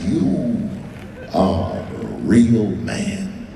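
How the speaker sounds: drawn-out gentle Russian boss; tender mobster. Retold or imitated. Russian boss praising the comic, low and very slow. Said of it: "He said".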